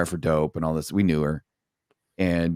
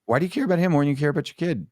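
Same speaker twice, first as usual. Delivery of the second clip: higher, quick accusing repeat; pointed confrontation; 8 semitones higher, much faster than usual. Retold or imitated. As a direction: retell a pointed challenge, pitched up and fast. Repeats the confrontation, sharper and faster.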